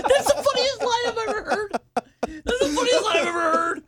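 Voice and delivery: high, laugh-choked delight; reacting to the bus driver clip, in tears of laughter; laughing hard. Real, not acted. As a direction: say it while cracking up. Host cracking up at clip.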